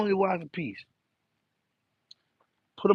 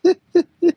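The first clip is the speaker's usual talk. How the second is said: high laugh; 14 semitones higher, 8 dB louder than usual. Real, not acted. Burst of laughter.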